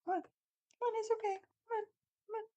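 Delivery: imitating Jen coaxing her dog, high and soft; mock coaxing owner. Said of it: "she turned around".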